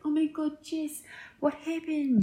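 put-on soft, high, light South African accent; imitating a South African crew member in a playful South African accent; mock South African accent. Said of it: "That's our South African accent".

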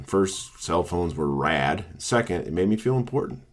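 ordinary talk

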